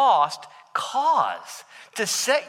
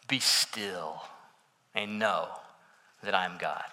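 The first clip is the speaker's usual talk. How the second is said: much lower, softer voice of God; 12 semitones lower, 8 dB quieter than usual. Retold or imitated. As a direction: drop deep and hushed as God speaking. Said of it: "You, you catch that change of voice there".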